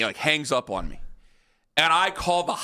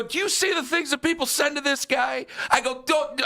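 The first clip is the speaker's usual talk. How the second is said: higher, pressing warning rising to a yell; retold urgent warning; 10 semitones higher than usual. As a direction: insist he needs security now.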